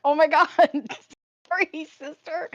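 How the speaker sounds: high, laughing apology; embarrassed surprise. Retold or imitated. Apologizing to her sister after the mix-up, high laughing. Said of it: "I was like".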